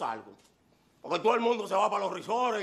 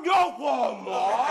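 slow, punched catchphrase; slow punchline; 3 dB louder, much slower than usual. Acted. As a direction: land it slowly.

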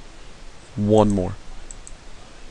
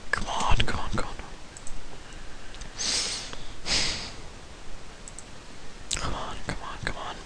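hushed, repeated plea; tense, very soft whisper; whispered urging; 19 dB quieter than usual. Real, not acted. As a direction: whisper it under your breath at the game. Willing the game to go well.

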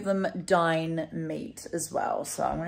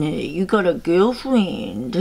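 slow sing-song cat talk; 5 dB louder, slower than usual. Real, not acted. Owner teasing her cat about a girlfriend, cooing. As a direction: tease the cat fondly, drawn out.

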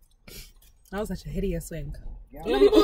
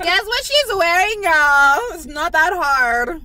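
loud teasing call; 8 semitones higher, 11 dB louder, slower than usual. Real, not acted. Teasing, calling out to viewers.

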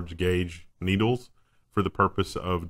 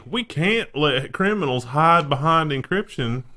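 slow drawled mock Southern accent, higher pitched; 5 semitones higher, 4 dB louder, slower than usual. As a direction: play a lazy Southern judge accent. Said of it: "I don't know that he has that accent".

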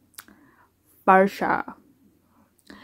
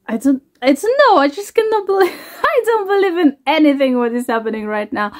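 excited disbelief; 6 semitones higher, faster than usual. Real, not acted. Overwhelmed by own song news.

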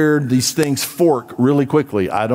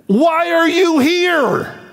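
much higher, slow, drawn-out demand; incredulous challenge; 14 semitones higher, much slower than usual. Acted. Preacher challenging a passive churchgoer, high and incredulous. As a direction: throw the question out in disbelief.

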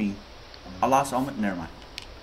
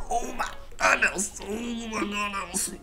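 high, squeaky wail of joy; giddy, high-pitched disbelief; overwhelmed excitement; 9 semitones higher, slower than usual. Real, not acted. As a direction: squeal it, stretching out the second one. Overwhelmed by the money total.

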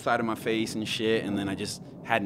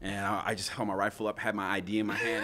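normal talking voice

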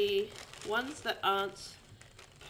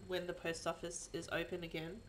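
ordinary talk, nothing marked

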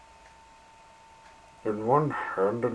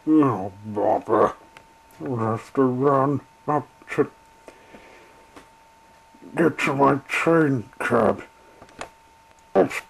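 louder, out of breath; puffing as he hurries; 4 semitones higher, 6 dB louder than usual. Acted. Engine puffing to his coaches as he sets off. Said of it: "he puffed".

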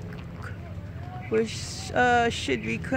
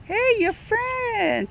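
drawn-out pet talk; 7 semitones higher, much slower than usual. Real, not acted. Calling the animal's attention.

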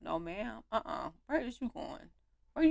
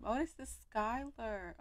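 normal talking voice

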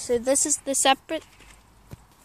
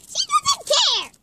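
very high, slow squeaky voice; slower than usual. Pretend. High put-on narrator voice.

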